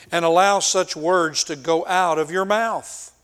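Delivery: loud, emphatic accusation; frustrated rebuke. Acted. Eliphaz rebuking Job for his words, frustrated. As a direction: confront him with mounting exasperation. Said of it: "you can feel the frustration he has".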